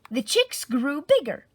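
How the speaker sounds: ordinary delivery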